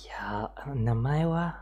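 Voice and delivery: usual speaking voice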